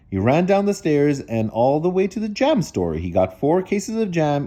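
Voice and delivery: usual speaking voice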